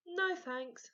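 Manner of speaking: lightly voiced mother declining; Mum.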